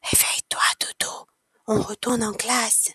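hushed, soft wake-up whisper. A narrator's reading. Child waking Doudou after the nap, whispering. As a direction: whisper gently to rouse someone asleep. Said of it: "elle chuchote".

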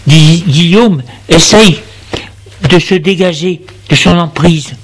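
ordinary delivery

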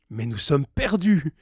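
high-pitched cry of dismay; frightened cry. A narrator's reading. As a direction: cry out in dread that all is lost. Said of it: "s'écria Gradelin avec effroi".

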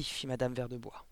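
plain reading voice

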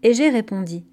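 plain reading voice

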